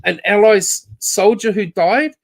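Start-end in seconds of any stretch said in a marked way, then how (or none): none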